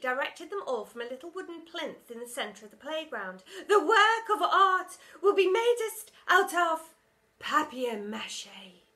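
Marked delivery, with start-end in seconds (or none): 3.7-8.8 high, loud, slow pronouncement; grand announcement. A narrator's reading. Teacher announcing to pupils, loud and grand. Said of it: "announced Miss Conceit".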